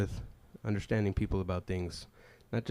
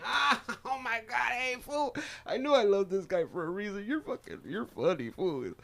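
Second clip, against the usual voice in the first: much higher delighted guy; high cousin voice; 11 semitones higher than usual. Retold or imitated. Cousin delighted by the joke, high-pitched praise. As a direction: crack up and praise him high.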